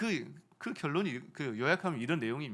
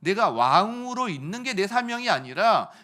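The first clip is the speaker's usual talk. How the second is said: loud, ringing cry of insight; Esther's realization; 5 semitones higher, 9 dB louder than usual. Acted. Preacher voicing Esther finding her mission, loud and ringing. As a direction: proclaim it as a sudden revelation. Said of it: "그는 깨달았어요".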